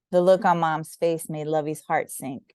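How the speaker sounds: usual speaking voice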